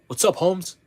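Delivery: hammed-up homeboy greeting. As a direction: imitate a store clerk's put-on homie talk. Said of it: "they'll be like".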